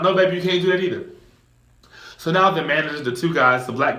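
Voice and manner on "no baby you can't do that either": put-on higher, fast, pleading girlfriend; girlfriend scolding. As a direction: hurry to stop her man, a bit higher. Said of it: "she pulls him down".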